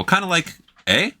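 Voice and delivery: tag a Canadian eh onto kinda like. Shown as a demonstration. Showing friends how Canadians say it, tacking on eh. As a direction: end kinda like with a rising eh. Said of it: "we say it at the beginning of sentences".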